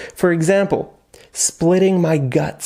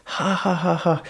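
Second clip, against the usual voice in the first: spoken ha ha ha, softer; mock laughter; 2 semitones higher, 4 dB quieter than usual. Shown as a demonstration. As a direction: lightly act out laughing hard. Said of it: "Laughing my ass off".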